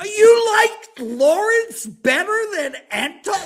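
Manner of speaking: high, slow, laughing and crying out the question; laughing disbelief. Real, not acted. Host asks his parents why Lawrence, laughing in disbelief. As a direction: ask it through tears of laughter.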